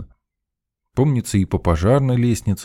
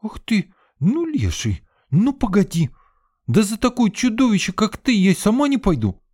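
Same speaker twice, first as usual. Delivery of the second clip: high indignant snort, much higher; snorting girl; 3 dB louder than usual. A narrator's reading. Sveta snorting at the narrator's teasing. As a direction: huff at him in mock outrage. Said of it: "фыркнула Света".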